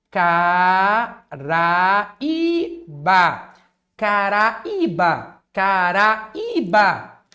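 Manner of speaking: Caraíba drawn out slowly, syllable by syllable; slow syllable demo. Shown as a demonstration. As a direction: spell out Caraíba slowly for the class. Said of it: "syllable demo for class". (Caraíba pronunciada devagar, sílaba por sílaba)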